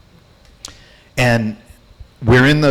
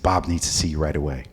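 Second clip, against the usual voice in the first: minion's message; 8 semitones lower, 8 dB quieter, faster than usual. Retold or imitated. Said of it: "one of his minions said".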